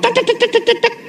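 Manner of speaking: mock nagging chatter. Imitating wife's nagging.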